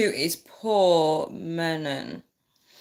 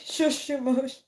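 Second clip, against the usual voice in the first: giggly shush; 7 semitones higher than usual. Real, not acted. Mock scold through laughter.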